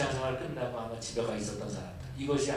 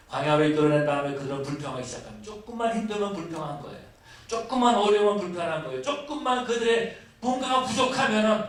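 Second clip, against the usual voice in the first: building, fervent sermon climax; fiery preaching; 9 semitones higher, 7 dB louder than usual. Acted. Pastor denounces the grumbling Israelites, fervent preaching. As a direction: drive the point home to the congregation. Preaching rises to full force.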